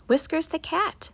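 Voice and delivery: usual speaking voice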